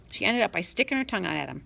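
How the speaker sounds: usual speaking voice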